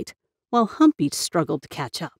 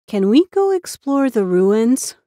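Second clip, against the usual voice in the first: higher, slow sweet asking; innocently; 6 semitones higher, 5 dB louder than usual. Acted. Girl asking uncle sweetly, innocent high tone. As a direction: ask like butter wouldn't melt. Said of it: "Summer said innocently".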